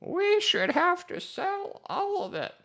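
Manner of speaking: put-on high, slow old lady voice; old grandma voice. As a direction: play a slow quavering granny. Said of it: "You guys like my old grandma voice?".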